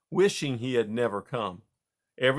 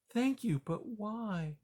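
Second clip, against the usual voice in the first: put-on higher, softer old mouse; puzzled but kind; gentle grandma mouse; 10 semitones higher, 6 dB quieter, slower than usual. Grandma Poppy.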